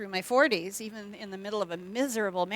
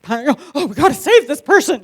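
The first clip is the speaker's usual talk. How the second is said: higher, much louder alarmed cry; mock panic; 6 semitones higher, 13 dB louder than usual. Mimicking TV characters in overblown alarm. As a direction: ham up a TV rescue panic. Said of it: "everyone's like".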